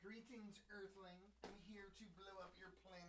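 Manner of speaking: high, soft little alien voice; lightly voiced cartoon Martian; Marvin the Martian. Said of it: "I was a big Marvin Martian fan".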